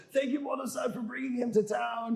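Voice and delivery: high-pitched mock grateful cry; imitated gushing thanks. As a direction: gush thanks like an overjoyed local. Grateful townspeople thanking him.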